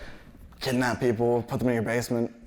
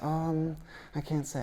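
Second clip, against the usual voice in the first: lightly voiced child, higher pitch; a hint of a small kid tattling; little kid; 6 semitones higher, 7 dB quieter, slower than usual. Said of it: "this kid walks up to us".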